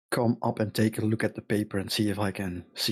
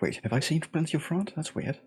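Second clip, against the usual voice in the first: puzzled surprise; 7 semitones higher than usual. Acted. Player voices doubt.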